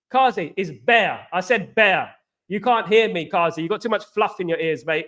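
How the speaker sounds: normal talking voice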